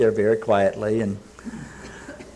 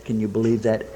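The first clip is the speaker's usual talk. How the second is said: ordinary talk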